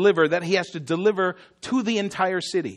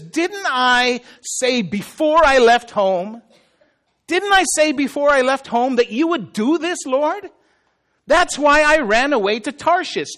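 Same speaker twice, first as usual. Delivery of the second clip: high, indignant protest to God; acted-out complaint, pitched up and insistent; complaining; 6 semitones higher, 4 dB louder than usual. Jonah complaining to the Lord that he knew it, indignant. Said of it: "he complained about it to the Lord".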